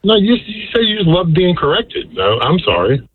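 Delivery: ordinary delivery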